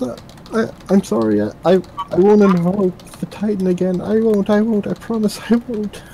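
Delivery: high, frantic begging, voice way up; terrified pleading girl. Acted. Captive girl begging her master in high terror.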